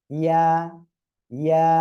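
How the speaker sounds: loud ইয়া, final "aa" held one count; lengthened vowel. Shown as a demonstration. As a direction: model ইয়া firmly on a level note. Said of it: "এক আলিফ টান হবে".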